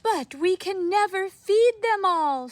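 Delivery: put-on high, loud fretting old woman; upset old woman. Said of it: "said the very old woman".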